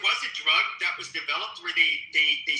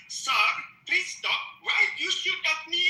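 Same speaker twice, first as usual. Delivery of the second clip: put-on high, pleading Indian accent; 13 semitones higher than usual. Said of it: "Sorry for the Indian accent".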